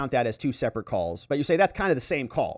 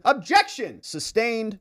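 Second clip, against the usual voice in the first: high, sharp courtroom interjection; dramatized objection and ruling, pitched high; acted objection; 8 semitones higher, slower than usual. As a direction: cut in like a lawyer objecting, then rule on it. Acted courtroom interruption by lawyer, judge.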